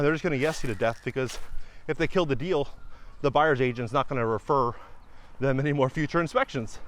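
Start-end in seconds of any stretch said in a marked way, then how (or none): none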